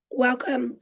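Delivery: ordinary talk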